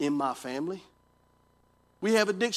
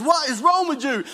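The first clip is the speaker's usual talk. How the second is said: startled wife; 8 semitones higher, 6 dB louder than usual. Retold or imitated. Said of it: "She jumps back like that and says".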